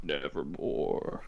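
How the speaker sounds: very low, soft and slow nevermore; ominous raven intoning. Pretend. Co-host answers the review in a slow, deep raven voice. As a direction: drag it out deep and dark like the raven. Solemn raven quote as joke.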